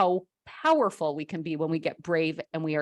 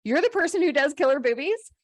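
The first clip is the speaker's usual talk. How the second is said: surprised disbelief; 8 semitones higher than usual. Retold or imitated. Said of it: "And she says".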